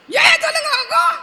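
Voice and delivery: very high puppet-character voice; டிங்கன். Pretend. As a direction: call out to the crowd in a squeaky high voice. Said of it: "டிங்கன் வந்து ஒரு கதை". (மிக உயர்ந்த பொம்மைக் குரலில்)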